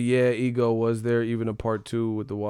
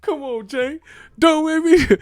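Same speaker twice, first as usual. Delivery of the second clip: much higher, louder pleading quote; pleading movie cop; 7 dB louder than usual. Retold or imitated. Mimicking the cop begging Jay, high and loud. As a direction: plead way up high, half joking. Repeating the movie standoff line.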